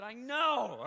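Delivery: loud fed-up I know; loud fed-up retort; exasperated I know. Retold or imitated. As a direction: groan it out, fed up. Deadpan exasperated reply.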